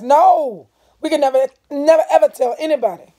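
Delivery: loud, fast, insistent no; firm refusal, pushed out fast; adamant. A narrator's reading. Stacy forbidding the others to tell, loud and adamant. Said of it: "declared Stacy ad-, adamantly".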